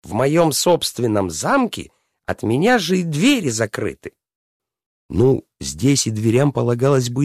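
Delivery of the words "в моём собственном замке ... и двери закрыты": high, indignant princess voice; angry princess. A narrator's reading. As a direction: complain like an affronted princess, pitched high. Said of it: "нашу гневную красавицу".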